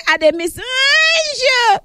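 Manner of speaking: high, drawn-out "I miss you" wail; wailed longing. Acted. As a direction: stretch it out in a high, playful cry. Acted message to absent co-host.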